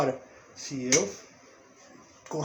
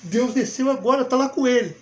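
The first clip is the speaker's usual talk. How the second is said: mock awe, rising higher and louder; awestruck follower; 4 semitones higher, 5 dB louder than usual. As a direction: play a churchgoer overcome by the preacher, building. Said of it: "Aí a pessoa". (fiel deslumbrado, imitação em tom crescente)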